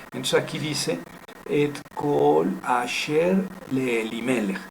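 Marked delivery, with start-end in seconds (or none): 1.5-4.6 slow, linked Hebrew reading; careful teaching demo with lengthened vowels; words read joined. Shown as a demonstration. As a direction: carry each word into the next, unhurried. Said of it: "leerse unida con la siguiente".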